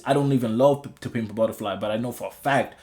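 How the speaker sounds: usual speaking voice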